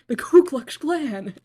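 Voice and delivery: high, loud, slow teasing blurt; gleeful drawn-out joke shout; cheeky blurt. Real, not acted. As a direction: drag it out for the joke. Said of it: "I just had to say it".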